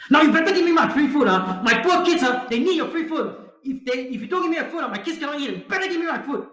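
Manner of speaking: high, insistent mock pleading; assertive demand. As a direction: suddenly demand it, pitch shooting up. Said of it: "she was humble and she was assertive".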